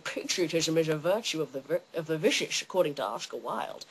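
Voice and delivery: low, soft mock Scottish slur; Sean Connery. Star quoting Oscar Wilde to his captor, low Scottish impression. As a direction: quote it with slushy Scottish s sounds. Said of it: "Sean Connery quotes Oscar Wilde".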